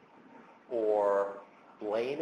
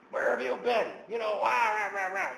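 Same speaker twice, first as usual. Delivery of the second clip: much higher, louder hammed-up complaining rant; mock angry barking; 13 semitones higher, 4 dB louder than usual. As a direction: bark at the wife in a mock tirade. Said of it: "kind of bark and complain".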